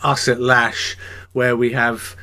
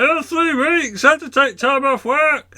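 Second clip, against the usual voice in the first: small high voice; 14 semitones higher than usual. Retold or imitated. Imitating the Transformer man's tiny high voice. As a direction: play the big guy with a tiny squeaky voice. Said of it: "And I said".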